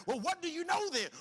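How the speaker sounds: Paul pressing the disciples in disbelief; incredulous question. Acted. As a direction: ask it like you can't believe them.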